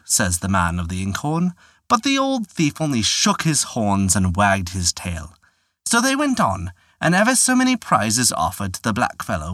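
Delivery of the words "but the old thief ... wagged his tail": usual speaking voice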